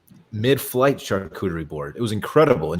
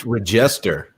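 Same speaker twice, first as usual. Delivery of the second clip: Regester with the middle syllable stressed, drawn out; stress on second syllable; slower than usual. Shown as a demonstration. Cohost corrects a surname, Regester stressed on the middle syllable. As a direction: lean slowly on the JEST in Regester. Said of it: "You put the wrong emphasis on the".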